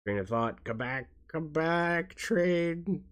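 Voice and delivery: high, slow sing-song call, come back, train; playful calling out. Pretend. Calling to her lost train of thought, high and slow.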